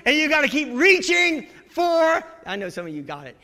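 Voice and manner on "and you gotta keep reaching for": shouted, higher and louder, for the crowd to finish; preacher shouting the saying; calling it out. Real, not acted. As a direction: belt the saying out to the congregation. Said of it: "anybody know what I'm talking about?".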